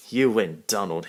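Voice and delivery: loud laughing concession. Acted.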